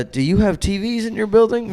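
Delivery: high-pitched imitation of a telemarketer; spam caller voice. Spam caller asking a gym about TVs, in a high mock voice. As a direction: put on a high call-center voice.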